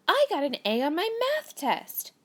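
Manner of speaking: high, bright burst of excitement; excited. Shown as a demonstration. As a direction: sound thrilled about the grade. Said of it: "it shows that I'm excited".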